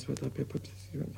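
frightened whisper while taking his pulse; whispered counting. Acted. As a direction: count the pulse under your breath in panic.

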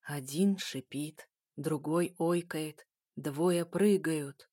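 низко, очень медленно, потусторонне; медленное зловещее пророчество; загробный голос. Acted. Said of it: "загробным голосом произнесла". (жуткий загробный голос)

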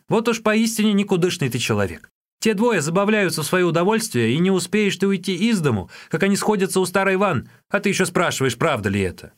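high-pitched exasperated scolding; angry. A narrator's reading. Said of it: "разозлился Юньге".

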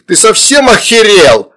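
high, loud, stretched-out outrage; furious shout. A narrator's reading. Mother confronts child-eating demon, furious yell. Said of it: "раздался бешеный крик".

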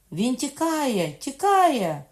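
high, loud, drawn-out cry of alarm; alarmed shouting. A narrator's reading. Guards report the king's escape, alarmed shouting. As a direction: shout the alarm across a courtyard. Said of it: "повернулися з криками".

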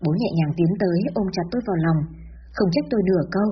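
ordinary delivery

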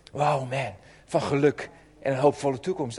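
usual speaking voice

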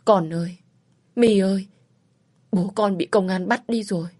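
mildly broken, tearful mother calling out; voice breaking. A narrator's reading. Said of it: "Giọng lạc đi gọi tên tôi". (giọng mẹ hơi lạc đi, gọi con đau xót)

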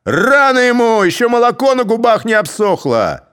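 loud high scornful yell; mocking shout. A narrator's reading. Cossacks jeering at the young candidate, loud. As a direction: jeer it at the crowd.

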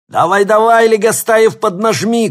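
shouting coach, high and loud; barking gym coach. A narrator's reading. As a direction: yell it across the track. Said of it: "кричал тренер".

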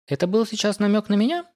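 high-pitched, wary query; suspicious, pitched well up; suspicious question. A narrator's reading. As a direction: ask it with rising suspicion. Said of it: "подозрительно спросила Ни".

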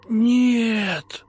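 high, pleading, terrified cry; pleading wheeze. A narrator's reading. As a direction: beg for mercy. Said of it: "умоляюще просипел".

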